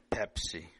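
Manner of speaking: plain reading voice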